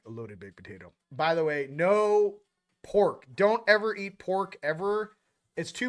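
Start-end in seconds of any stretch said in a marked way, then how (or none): 0.0-0.9 much lower, laid-back bro voice; deep casual bro voice. Retold or imitated. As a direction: drop the voice low and casual, like it's obvious. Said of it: "I'm like".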